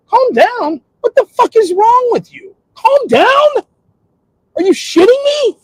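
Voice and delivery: high, loud, incredulous outburst; heated. Retold or imitated. Streamer reenacting a blow-up at viewers, furious shouting. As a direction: blow up at being told to calm down. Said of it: "I would get a little heated".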